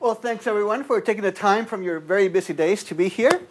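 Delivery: ordinary talk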